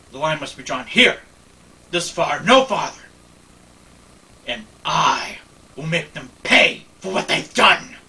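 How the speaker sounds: defiant vow. Acted. Picard vowing to Lily to make the Borg pay, building anger. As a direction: draw the line with rising fury.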